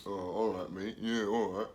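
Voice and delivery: put-on unconvinced teen, muttering; sceptical kid. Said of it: "Your kid's thinking".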